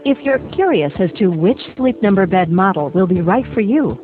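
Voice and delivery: recorded hold message over music; phone menu voice. Acted. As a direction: read it like an upbeat on-hold ad.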